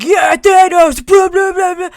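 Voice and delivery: hammed-up obnoxious fan; mock loud fan. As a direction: ridicule the loud guys. Mocking loud fans.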